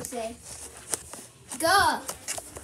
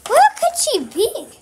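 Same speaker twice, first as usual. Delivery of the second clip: raised, high searching call; 6 semitones higher, 7 dB louder than usual. Real, not acted. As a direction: call out loudly through the house. Searching for hidden grandma.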